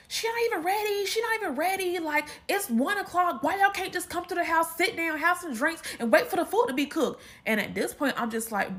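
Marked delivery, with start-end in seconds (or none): 0.1-7.2 high, exasperated complaint; tense sister. Retold or imitated. Imitating sister upset that mom isn't ready, high exasperation. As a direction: vent it, stressed and fed up. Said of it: "my sister is in a tensy".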